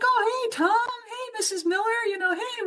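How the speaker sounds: very high, acted-out hellos; imitated friendly calling to passers-by; called-out greetings. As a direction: greet one acquaintance after another, pitched right up. Acting out greeting everyone around her.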